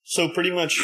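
ordinary talk, nothing marked

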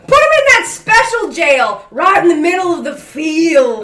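barking a shouted order. Acted. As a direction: call it out like a command. Judge ordering the bailiff.

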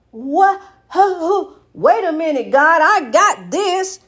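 high, sputtering protest; acted-out objection, pitched high and emphatic; indignant disbelief. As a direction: argue back, stretching the final words. Reenacts arguing back at God.

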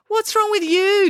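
high, drawn-out indignant demand; exasperated demand. A narrator's reading. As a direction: demand it, pitch jumping up. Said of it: "demanded April".

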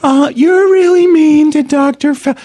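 high, loud whiny mock voice; imitating the mockery. Host mockingly redoing the guest's impression of him. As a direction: throw the squeaky parody right back. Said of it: "that a good impre".

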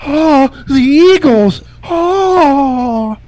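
high, loud and slow, gushing over "Eagles"; hammed-up gushing. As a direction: swoon over the Eagles, way overdone. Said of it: "Is that better?".

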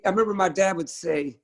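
usual speaking voice

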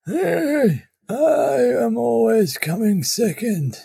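mock whining. Cohost playfully insisting the other go first, mock whining. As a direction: stretch it out in a squeaky mock cry.

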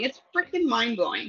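ordinary talk, nothing marked

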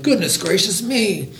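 high, lightly acted surprise; startled exclamation. A narrator's reading. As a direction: exclaim it in mild storybook shock.

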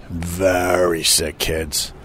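VERY stretched long and low; Very drawn right out for gravity; drawn-out emphasis. Real, not acted. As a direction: repeat it slowly, leaning on Very. Repeat for emphasis.

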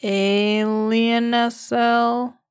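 stretched slowly and playfully; slow drawn-out. Pretend. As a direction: drag out each letter. Joking sign language riff.

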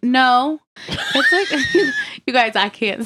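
bursting into laughter mid-sentence; laughing. Real, not acted. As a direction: laugh through the words. Laughing while trying to explain.

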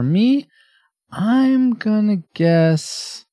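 slow, high, drawn-out tease; drawn-out suspense. Real, not acted. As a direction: stretch it out to build suspense. Building suspense before guessing.

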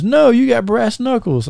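high-pitched mock objection; imitated referee's refusal. Referee objecting to the brass knuckles, high imitated protest.

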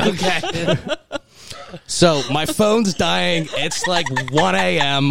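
high, giggly, slowed words; laughing while talking. Real, not acted. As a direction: talk through the giggles. Laughing after being teased.

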